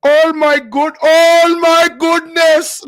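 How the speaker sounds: high, loud, drawn-out mock Indian accent; put-on Indian accent. Streamer practicing his accent for chat, high loud Indian accent. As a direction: exclaim in an exaggerated Indian accent. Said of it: "I gotta hang around some Indians".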